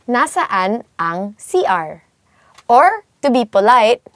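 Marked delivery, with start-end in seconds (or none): 0.1-2.1 "Nasaan ang C R" slowly, word by word; slow teaching repeat. Shown as a demonstration. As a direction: space out "Nasaan ang C R" for learners.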